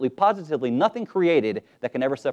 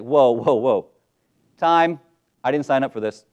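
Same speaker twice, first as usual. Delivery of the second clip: louder startled "whoa", calling time out; alarmed protest; 6 dB louder than usual. Retold or imitated. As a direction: throw up your hands and call time out. Paul voiced balking at suffering.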